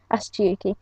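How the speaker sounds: plain reading voice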